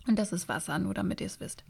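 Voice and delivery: ordinary delivery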